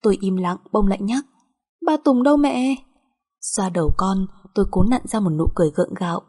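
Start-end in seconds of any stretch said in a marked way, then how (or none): 1.8-2.8 put-on high, insistent childish question; little child. Small daughter asking mother about father, childish.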